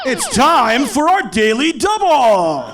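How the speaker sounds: big booming announcer voice, stretched out slow; game show host. Shown as a demonstration. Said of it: "That was my game show host voice".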